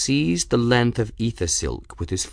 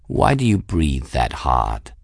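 low-pitched, mildly curious cat; 6 semitones lower than usual. A narrator's reading. Raoul riding on climbing Bridget's shoulders, curious. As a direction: ask it low, with idle curiosity. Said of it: "the cat asked her curiously".

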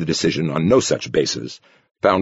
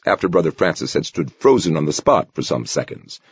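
usual speaking voice

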